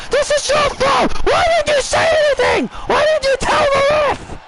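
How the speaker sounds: high, furious accusing yell at her tag partners; angry Shotzi. Acted.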